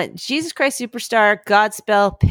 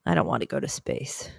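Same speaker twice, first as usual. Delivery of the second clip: whispering; 9 dB quieter than usual. Real, not acted. Whispered reply.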